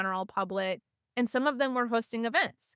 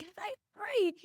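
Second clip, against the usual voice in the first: very high little call; mock calling out; 12 semitones higher than usual. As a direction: imitate flagging someone down in a high voice. Re-enacting flagging people down.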